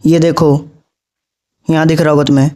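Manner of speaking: ordinary delivery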